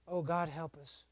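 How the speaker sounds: low, very soft prayer; hushed plea. Real, not acted. Preacher pleading with God for help, hushed plea. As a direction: murmur it as a prayer. Quiet plea after the rebuke.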